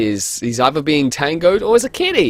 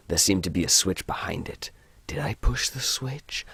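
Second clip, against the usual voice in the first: unvoiced hushed read; whisper; 4 semitones lower, 6 dB quieter than usual. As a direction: whisper it secretively. Reading game text hushed.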